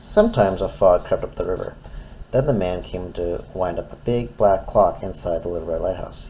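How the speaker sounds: ordinary delivery, nothing marked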